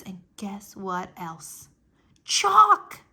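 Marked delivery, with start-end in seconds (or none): 2.2-3.0 high, loud burst of joy; excited shout. Acted. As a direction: blurt it out, thrilled. Junie finds chalk, shouts it.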